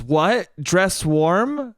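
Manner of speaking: mock puzzled query, pitch lifting a little; questioning mom. Mom needles dad over his wording, questioning. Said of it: "my mom goes".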